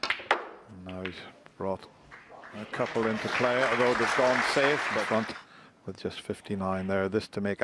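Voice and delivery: soft live snooker commentary; hushed commentary. Real, not acted. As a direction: murmur the play-by-play.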